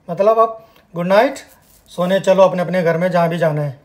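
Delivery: ordinary talk, nothing marked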